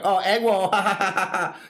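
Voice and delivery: high, loud mocking jeer with a cackle; hammed-up mocking laugh at her; mocking drunk voice. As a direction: sneer it up high like a drunk heckler. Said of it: "they were making fun of her".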